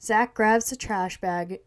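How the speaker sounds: ordinary delivery, nothing marked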